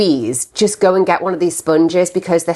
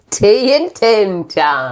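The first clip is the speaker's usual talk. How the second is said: show title; much slower than usual. Acted. Announcing the podcast title.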